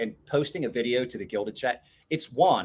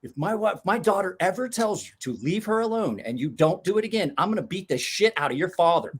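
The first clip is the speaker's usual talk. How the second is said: threatening; 7 semitones higher than usual. Retold or imitated. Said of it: "is like".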